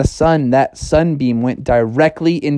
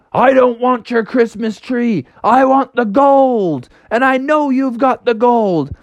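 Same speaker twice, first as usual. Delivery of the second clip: greedy bear; 7 semitones higher than usual. Acted. Bear demands gold.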